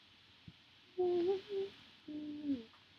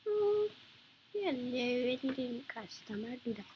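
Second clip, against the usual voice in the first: normal talking voice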